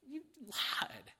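hushed, whispered mock accusation; disbelieving whisper to a fibbing child. Mock accusation of lying son.

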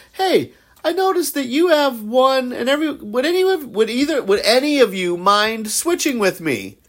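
high-pitched acted asking; imagined polite request. Voices polite request to friends.

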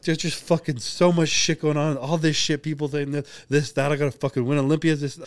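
a touch of tearful outburst, slowed; retold crying vent. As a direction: let it all spill out, voice up and tight. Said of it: "And then I start bawling, I'm crying".

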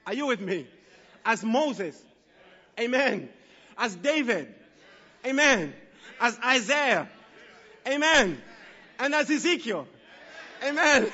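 rousing preacher call and response; preaching. Acted. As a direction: preach it with rising fervour.

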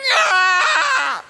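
loud, high mock shriek; toddler tantrum scream. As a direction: yell out like a child melting down.

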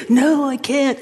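whiny put-on protest; mock helpless refusal. The person answers the nagging thing, mock helpless. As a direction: resist feebly, hammed up.

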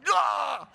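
loud, high mock yell of alarm; imitated startled cry. Father-in-law mimicking the cat before impact, loud startled cry. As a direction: yelp like an animal about to be hit. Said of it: "Like that".

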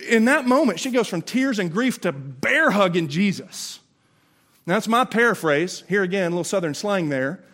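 usual speaking voice

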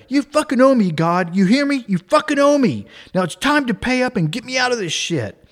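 high, loud mock tirade at God; full argument. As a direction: argue furiously with God, acted out, pitched high. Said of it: "he just goes full argument with God".

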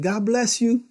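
high, sing-song God bless you, with a touch of mischief; sweet blessing. Retold or imitated. Husband answers the cursing driver with high sweet blessing. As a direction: kill her rudeness with sugary kindness. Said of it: "I said".

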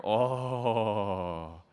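long drawn-out "oh" of delight; blissful groan. Acted. Savouring the muffins he loves.

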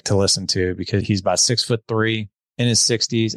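ordinary talk

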